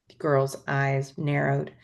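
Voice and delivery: usual speaking voice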